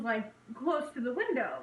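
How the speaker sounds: ordinary talk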